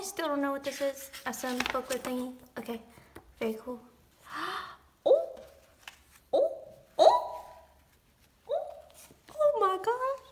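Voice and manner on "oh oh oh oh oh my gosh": high loud breathless shrieks; excited squeal. Real, not acted. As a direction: squeal each oh in delight. Squealing at new card.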